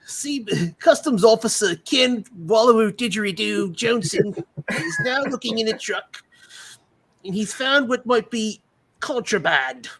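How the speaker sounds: high, loud mock Australian TV narrator; bad Australian accent. Parodying Border Security Australia, bad Australian accent. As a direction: narrate like a comically bad Australian crime show. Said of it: "Today on Accents Done Poorly".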